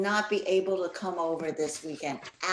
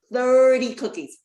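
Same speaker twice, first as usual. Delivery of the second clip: "thirty" punched high and loud, "cookies" following; mother stressing "thirty" to the accused family; 7 semitones higher, 9 dB louder than usual. Acted. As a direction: hit "thirty" hard to drive home the count. Mother confronts family over cookies.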